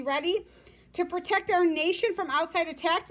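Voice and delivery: ordinary delivery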